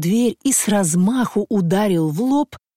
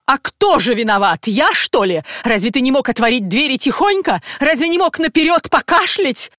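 higher, louder nagging; angry scolding; 7 semitones higher, 4 dB louder than usual. Acted. Wife scolds husband for not knocking, high angry nag. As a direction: berate him like a shrew.

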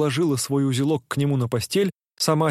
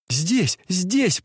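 eager catching up; 5 semitones higher than usual. A narrator's reading. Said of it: "подхватила Настенька".

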